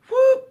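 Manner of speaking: short high, loud whoop; whistle blast imitation. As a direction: hoot like a guard's whistle. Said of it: "He blows the whistle again".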